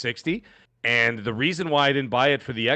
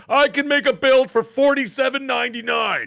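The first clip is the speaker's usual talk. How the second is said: high-pitched mocking brag; mock boastful builder; 12 semitones higher, 4 dB louder than usual. Host mimicking people who boast about cheap builds, mocking brag. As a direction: imitate an overeager PC builder, pitched way up.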